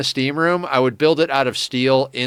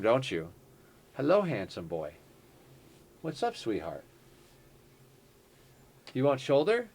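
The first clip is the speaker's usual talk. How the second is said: femme fatale; 6 dB quieter than usual. Real, not acted. Talking sweetly to the cat.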